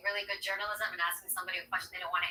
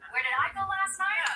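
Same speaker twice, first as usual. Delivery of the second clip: higher and louder, slow, defensive; acting out a liar dodging a question, higher and louder; defensive counter-question; 10 semitones higher, 5 dB louder than usual. Shown as a demonstration. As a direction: bounce the question back, mock-indignant. Demoing a liar answering with question.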